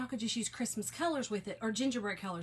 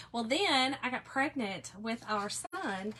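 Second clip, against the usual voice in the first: usual speaking voice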